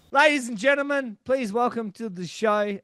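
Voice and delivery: high, loud ring-announcer welcome; announcer intro. Pretend. Host welcomes the ring announcer guest, announcer voice.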